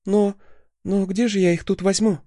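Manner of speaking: high, flustered cry; flustered exclamation. Acted. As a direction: blurt it out, caught off guard. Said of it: "возгласом девушки".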